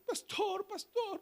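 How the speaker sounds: very high, mock tearful little voice; imitating crying child. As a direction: plead like a small child in tears. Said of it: "el niño venía llorando".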